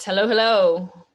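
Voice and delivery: ordinary talk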